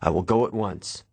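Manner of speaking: ordinary delivery, nothing marked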